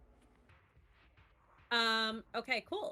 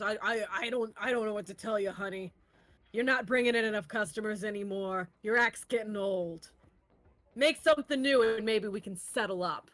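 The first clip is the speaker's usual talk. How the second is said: sleazy boss brushing her off; 6 dB louder, faster than usual. Acted. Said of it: "and he goes".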